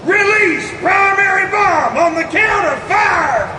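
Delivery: wild countdown shout. Acted. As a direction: count it down in giddy excitement. Countdown riding the bomb.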